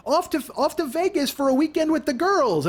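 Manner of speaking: high, sing-out mock of a breezy post; mocked social post. Host reads the car-wrecker's Vegas post, mockingly high. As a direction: parody her holiday brag in a high voice.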